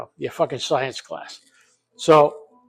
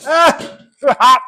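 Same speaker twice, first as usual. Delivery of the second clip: much higher, louder sudden ouch; real yelp of heat; 11 semitones higher, 11 dB louder, slower than usual. Real, not acted. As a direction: jump at the heat of the pot. Burned by hot pot.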